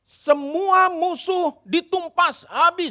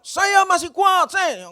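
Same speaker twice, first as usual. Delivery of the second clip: eager shout; 5 semitones higher, slower than usual. Acted. Soldier volunteers loudly.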